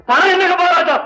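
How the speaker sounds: outraged musician shouting at the maharaja; indignant outburst. Acted. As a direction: fling the question at the king.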